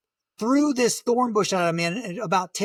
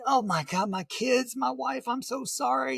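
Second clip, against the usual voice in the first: desperate plea; 6 semitones higher, 3 dB quieter than usual. Retold or imitated. Pleading to God while hiding.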